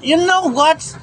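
high, rattled and breathless; shaken after jump scare. Real, not acted. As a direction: still shaking from the scare. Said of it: "literally fucking jump scared me".

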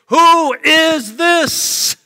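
loud, high, drawn-out call; crowd's cry. Acted. Packed city asks about Jesus, loud drawn-out cry. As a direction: cry it out slowly for a whole city.

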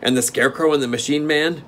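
steward. Acted. Steward asks.

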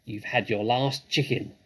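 plain reading voice

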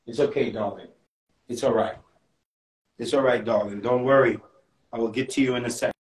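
put-on calm the crying child; soothing. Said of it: "Relly mother's cries".

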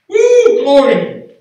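very high, loud whoop of glory; joyful church whoop; ecstatic whoop. Real, not acted. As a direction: let it burst out. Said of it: "Can I do a little jumping".

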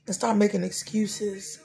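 steady, earnest prayer voice with chanted repeats; spoken church prayer. Acted. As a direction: pray it aloud for the congregation. Leads an opening prayer.

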